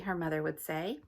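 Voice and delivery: usual speaking voice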